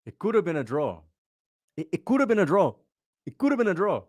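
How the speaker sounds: put-on a light Nigerian accent on each repeat. Mocking the Nigerian guest's draw claim in a Nigerian accent. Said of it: "I'm literally doing a Nigerian accent".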